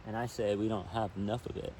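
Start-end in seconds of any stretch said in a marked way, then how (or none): 0.1-0.1 lively storybook reading, a bit louder; story narration. A narrator's reading. Reading a children's book aloud by the river, storytelling narration. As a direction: read it out like a picture book for kids.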